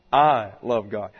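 long, slow "I" ahead of love God; stress on I. Shown as a demonstration. Preacher quotes self-centred people, stress on I. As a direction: make "I" the big word. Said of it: "emphasis on the I".

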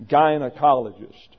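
"Gynecologist" with a hard G, guy-neh; loud, hard G on "Gynecologist". Shown as a demonstration. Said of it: "You have that hard G".

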